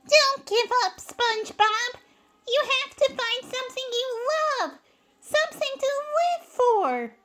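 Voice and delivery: high, loud, pleading character voice; desperate plea. A narrator's reading. Sandy begs SpongeBob not to give up, desperate loud plea. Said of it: "she said desperately".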